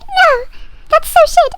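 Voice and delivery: very high, loud mock dismay; hammed-up horrified commiseration; dramatic sympathy.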